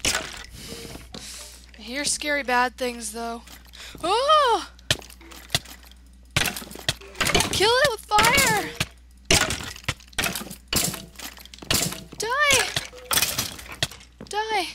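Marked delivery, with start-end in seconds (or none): 4.0-14.7 high, loud frantic yells; battle yelling. Real, not acted. Gamer attacking a monster that startled them, yelling. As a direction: shout it in a sudden fight.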